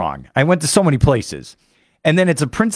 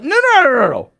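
dismissive no; 10 semitones higher than usual. Real, not acted. Waving away the tourist sights.

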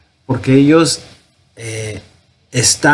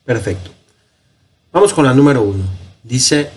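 ordinary talk, nothing marked

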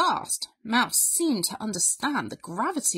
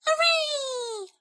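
high, squealed mouse cheer; drawn-out little squeal of joy; delighted mouse. A narrator's reading. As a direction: cheer as a thrilled tiny mouse.

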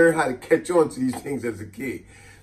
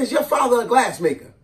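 repeating the aunt; 7 semitones higher, 4 dB louder than usual. Retold or imitated. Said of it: "I said".

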